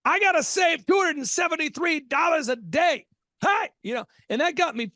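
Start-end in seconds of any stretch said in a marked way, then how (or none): 0.0-3.1 excited shout. Retold or imitated. Host reliving his teenage money goal with an excited shout. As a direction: shout out the discovery like a eureka.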